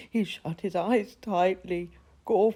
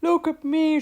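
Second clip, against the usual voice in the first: much higher, louder shriek; excited shriek; 11 semitones higher, 7 dB louder than usual. Acted. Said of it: "shrieked Gorf with excitement".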